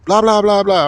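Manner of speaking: mocking babble after the imitated commenter; dismissive blah blah. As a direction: wave off the long comment rant.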